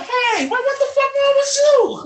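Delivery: high, loud outraged shout; high-pitched outraged yell at a reckless hero; incredulous yell. Retold or imitated. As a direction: yell at him in disbelief, climbing up high. Outraged at Batman throwing things.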